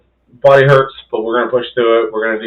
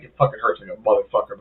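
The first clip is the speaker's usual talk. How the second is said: muttered aside; 3 semitones lower, 9 dB quieter than usual. Retold or imitated. Inner thought about pain.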